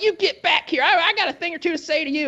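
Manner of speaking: high, put-on scolding manager voice. Braces manager ordering him back, high put-on scolding voice. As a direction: mimic the manager snapping at him.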